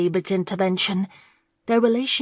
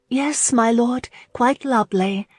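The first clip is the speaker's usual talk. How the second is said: purring; 5 semitones higher, 3 dB louder than usual. A narrator's reading. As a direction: sweetly flatter the lord. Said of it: "Marlene purred".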